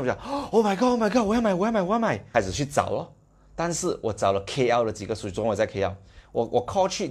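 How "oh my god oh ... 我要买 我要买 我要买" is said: much higher, louder, thrilled "I want it" cries; retold giddy excitement at the news; excited squeal. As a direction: burst out like a fan who must have it. Retells his own joy at the news.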